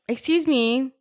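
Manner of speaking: mock polite ghost. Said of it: "spirit of a maid is like".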